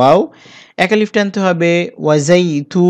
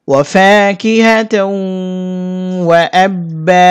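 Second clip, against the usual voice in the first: drawn-out chanted ওয়া ফাকিহাতাও; slow tajweed chant; slow recitation; much slower than usual. Shown as a demonstration. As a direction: chant slowly with long vowels. Recites joined verse.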